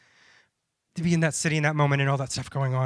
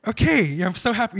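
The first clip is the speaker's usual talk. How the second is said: nervous "oh, oh", then bright mock "I'm so happy"; forced cheerfulness; 3 semitones higher, 6 dB louder than usual. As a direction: cover anxiety with a cheerful front. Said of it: "I really was so genuine".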